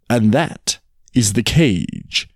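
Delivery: slow, pointed, pausing phrases; laboured explanation. Acted. Tiger teaches the jackal about the cage, slow and pointed. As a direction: spell it out as to a dunce.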